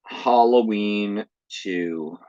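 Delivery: slow, spooky title read; slow drawn-out title. Acted. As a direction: stretch the title out slowly.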